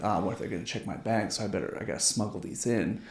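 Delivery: fast, hushed worry, mildly acted; retold freak-out. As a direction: hurry it out under your breath, imitating nerves. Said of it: "like, freaking out".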